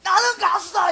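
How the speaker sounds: high, loud proclamation; singer's proud declaration. Retold or imitated. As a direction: declare it boldly as the singer's message. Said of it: "그 사람들이 하는 메시지가 뭐예요". (가수가 당당히 외치듯)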